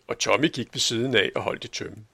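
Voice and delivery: usual speaking voice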